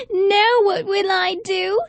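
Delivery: plain reading voice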